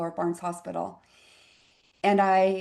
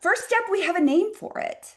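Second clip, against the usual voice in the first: animated; 12 semitones higher, 4 dB louder than usual. Real, not acted.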